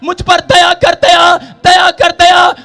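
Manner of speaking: slow, drawn-out shouted plea; crying out loudly. Acted. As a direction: call out for mercy, stretching each phrase. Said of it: "जोर जोर से चिल्ला रहे थे". (ज़ोर से चिल्लाकर पुकारना)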